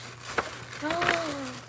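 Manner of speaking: a soft, breathy delighted gasp; real gasp of delight. Real, not acted. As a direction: catch your breath at the gift. Unwrapping a gift.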